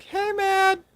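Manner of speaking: put-on squeaky cute VTuber girl; cute anime streamer. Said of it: "I could just try to do, like, a voice".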